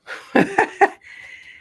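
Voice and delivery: breathy high giggle; quick unvoiced laugh at herself; laughing alone while tidying; airy laugh. Real, not acted.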